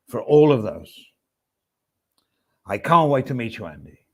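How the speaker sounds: loud, halting impression, each phrase fading away. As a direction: start each phrase strong, stretch it, trail off. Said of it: "That's my impression of Oz".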